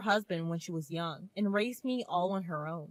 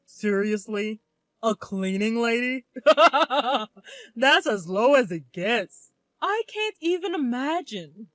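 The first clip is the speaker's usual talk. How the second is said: sneering, laughing snob voice; mocking laughter; 2 semitones higher, 8 dB louder than usual. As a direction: laugh her down with scorn. Family laughing at mother.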